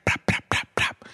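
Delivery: quick voiceless popping plops; imitated plopping. Storyteller mimics rum bottle falling from glove compartment, popping plops. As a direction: mimic a bottle tumbling out in little pops.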